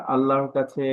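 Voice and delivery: ordinary talk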